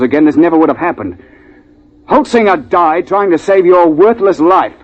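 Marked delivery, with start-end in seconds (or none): 2.1-4.7 higher, heated accusation; rising anger. Acted. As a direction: throw the accusation at him. Confronting the coward.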